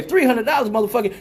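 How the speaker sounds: put-on high-pitched outburst without accent; mock outrage in his own accent; accent dropped. Customer repeating the shocking price, accent dropped. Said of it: "the whole accent go away".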